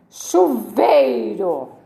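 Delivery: "Chuveiro" slowly as chu-VEI-ro; "Chuveiro" drawn out in three beats; syllable emphasis. Shown as a demonstration. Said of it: "Três sílabas".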